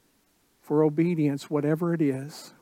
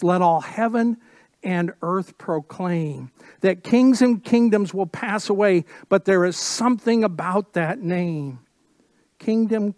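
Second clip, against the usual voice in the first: louder, ringing declaration of kings and kingdoms passing; fervent proclamation; 3 semitones higher, 6 dB louder than usual. Acted. Pastor proclaiming the old song's words to the church, fervent preaching. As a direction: build it up like a call to worship.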